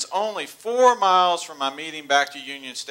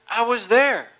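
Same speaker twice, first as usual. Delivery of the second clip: surprised realization; 8 semitones higher, 4 dB louder than usual. Real, not acted. Realizing it was March.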